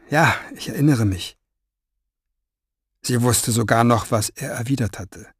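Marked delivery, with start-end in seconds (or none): none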